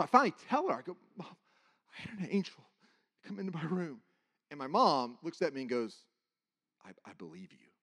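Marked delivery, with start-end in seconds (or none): 1.2-4.0 soft, halting phrases; retold hushed telling; shaken confession. Finally tells mother through tears. 6.8-7.7 very low, hushed reply; retold quiet reassurance; solemn mother.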